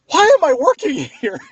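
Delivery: high, loud, laughing disbelief; laughing, loud exasperated cry; laughing exasperation. Retold or imitated. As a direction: crack up mid-question in loud despair.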